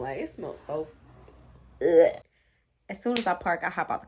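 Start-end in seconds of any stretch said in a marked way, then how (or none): 1.8-2.2 loud retching ugh of disgust; a gagging groan at the stink; loud disgusted grunt. Retold or imitated. Disgust at the smell.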